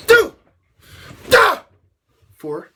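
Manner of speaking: loud high fighting noises; mock strike sounds. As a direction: punch out each sound like a blow. Imitating combat strikes.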